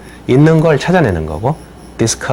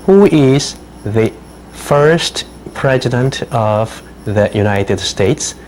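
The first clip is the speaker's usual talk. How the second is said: each word drawn out slowly for dictation; slow, careful dictation voice; very slow dictation read; much slower than usual. Shown as a demonstration. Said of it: "teacher reads a dictation question".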